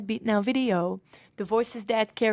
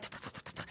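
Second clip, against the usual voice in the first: knees knocking; 16 dB quieter, faster than usual. Retold or imitated. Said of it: "I was able to hear my knees".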